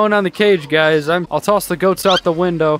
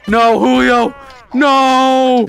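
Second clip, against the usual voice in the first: louder, despairing no; dismayed cry; 5 semitones higher, 4 dB louder, much slower than usual. Real, not acted. Watching Julio die in the crash, loud dismayed cry.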